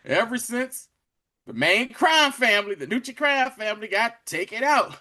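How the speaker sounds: high, loud, lightly imitated newscast; a hint of urgent newsreader; retold news report. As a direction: relay it like a breaking news bulletin. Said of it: "a news report playing in the background".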